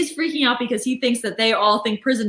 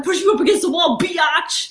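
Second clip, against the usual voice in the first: mock shout; 6 semitones higher than usual. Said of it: "he says to Angela".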